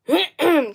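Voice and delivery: sharp little cough; character cough. Shown as a demonstration. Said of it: "Or go".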